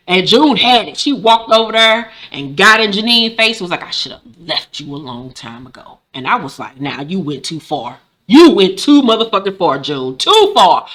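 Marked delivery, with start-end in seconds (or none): 3.8-6.0 low, hushed acted menace; quiet, cutting put-down; cold retort. June in Janine's face, acted. 6.8-8.0 low, soft reproach; hushed, low reaction; quiet disapproval. Retold or imitated. Reaction to June, first take. 8.3-10.9 loud, punched-up scolding; exaggerated outraged telling-off; emphatic reproach. Same reaction, escalated.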